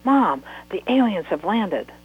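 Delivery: put-on child shouting to wake her mother; excited shout. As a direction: burst in shouting the big news. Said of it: "as she shouted".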